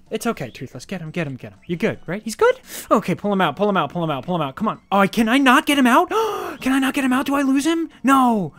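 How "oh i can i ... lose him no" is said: panicky. Real, not acted. Pet stuck, fears losing it.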